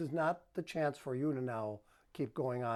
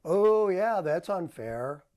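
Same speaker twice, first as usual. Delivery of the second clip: sarcastic; 2 semitones higher, 7 dB louder, slower than usual. Shown as a demonstration. Husband brushing off his wife's complaint, loud sarcasm. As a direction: overdo the agreement so it mocks her. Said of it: "saying it with sarcasm".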